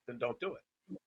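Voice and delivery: low, soft, offhand retort; unbothered reply. Retold or imitated. Trainer answering arguing client, unbothered. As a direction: drop it low and quiet, indifferent.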